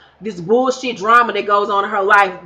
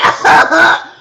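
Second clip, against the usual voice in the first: laughing; 7 semitones higher, 7 dB louder than usual. Real, not acted. Breaking up after the fake cry.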